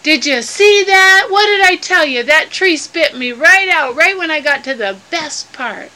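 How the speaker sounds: loud, high opening that eases down; worked-up indignation. Acted. Mel protesting to Inchi after falling from the tree, indignant. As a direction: play an indignant, vindicated storybook character.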